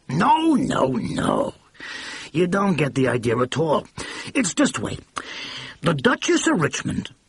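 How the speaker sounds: a blustering mogul catching his mistake; excited brash producer. A narrator's reading.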